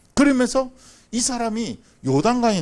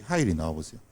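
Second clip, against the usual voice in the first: ordinary talk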